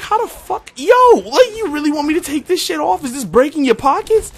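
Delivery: very high, loud ranting disbelief; incredulous outburst. Retold or imitated. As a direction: re-enact blowing up at a petty complaint. Acted outburst at petty customer.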